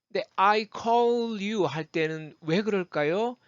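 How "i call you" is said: "I call you" with the L sounded into you; slow linked "I call you". Shown as a demonstration. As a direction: join call to you through a clear L. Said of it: "음가가 살아나는 거니까요".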